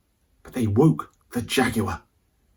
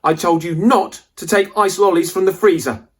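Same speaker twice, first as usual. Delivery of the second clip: high, loud scolding mother's call; a mother shouting at her son; 7 semitones higher, 4 dB louder than usual. Acted. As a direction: tell off the older brother from across the garden. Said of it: "a voice shouted".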